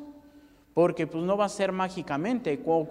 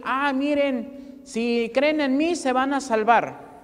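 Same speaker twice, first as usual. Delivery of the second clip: voice of the Lord; 5 semitones higher, 4 dB louder, slower than usual. Retold or imitated. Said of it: "no nos vino a decir".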